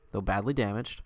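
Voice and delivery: ordinary talk, nothing marked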